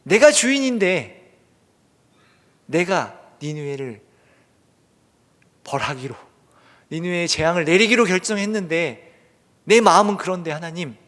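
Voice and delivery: mildly indignant mock grievance; Jonah. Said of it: "화를 내고 있죠".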